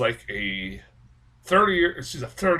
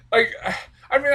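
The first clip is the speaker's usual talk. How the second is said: ordinary talk, nothing marked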